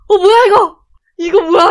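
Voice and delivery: loud, high-pitched disbelief; re-enacted burst of startled excitement; excited surprise. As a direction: blurt it out in astonishment. Acts out surprise at catching towel.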